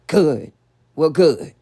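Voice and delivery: loud, forceful "good"; verbal assault. Shown as a demonstration. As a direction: throw each good out hard, like an attack. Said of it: "That's an assault".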